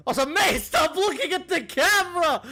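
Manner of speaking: loud, high-pitched hammed-up shouting; over-the-top squealed protest; mock freaked-out yell. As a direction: shriek it in exaggerated alarm. Mock-freaked outburst at someone.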